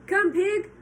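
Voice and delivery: loud, high call to the pig; farmer calling. Acted. Mister Zuckerman calling the pig, loud.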